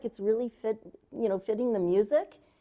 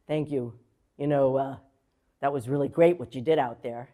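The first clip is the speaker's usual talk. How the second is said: lower, relaxed man's voice; Gregory Hines; 9 semitones lower than usual. Retold or imitated. Gregory Hines praising the student, lightly voiced and lower. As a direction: lightly voice the kind tap legend. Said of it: "he looked down at me and he said".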